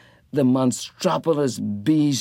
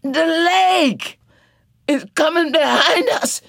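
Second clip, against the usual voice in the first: high, louder panicked voice; frightened cry; 4 dB louder than usual. A narrator's reading. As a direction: cry out in fear as the water comes. Janey fleeing the flood.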